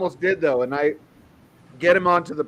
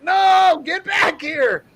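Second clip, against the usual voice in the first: much higher, louder call with a stretched, slow "get back here"; yelling after him; 13 semitones higher, 6 dB louder, much slower than usual. Retold or imitated. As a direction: call out across the lot to stop him. Security guard yelling after him.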